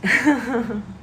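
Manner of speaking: short falling chuckle; laughing. Real, not acted. Healer pleased after saying it feels amazing, laughing. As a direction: let out a little laugh.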